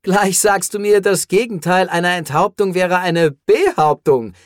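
high, loud, sustained disbelief; incredulous banter. Acted. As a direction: throw the absurdity back at them. Hero jokes back in disbelief.

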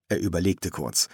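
usual speaking voice

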